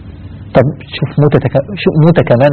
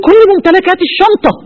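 much higher, clutching at his money in panic; desperate dying man. Retold or imitated. Dying passenger on the plane begging for his briefcase, high and frantic. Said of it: "يقول له".

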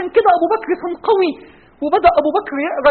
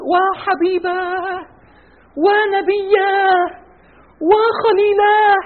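very slow, higher mournful lament; 5 semitones higher, much slower than usual. Acted. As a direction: draw out each word in grief. أبو بكر يرثي النبي. (رثاء حزين بطيء جدا)

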